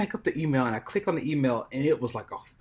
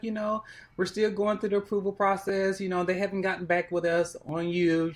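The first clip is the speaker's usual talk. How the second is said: lightly higher-pitched producer voice; a hint of a polite casting producer; producer's voice; 7 semitones higher than usual. Producer on the phone, lightly higher polite voice.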